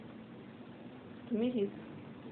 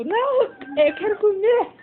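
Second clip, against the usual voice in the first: much higher, louder, crying whine; dog's whiny voice; 8 semitones higher, 5 dB louder than usual. Pretend. Owner speaking for her hungry dog, crying whiny voice. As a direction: whimper the dog's complaint.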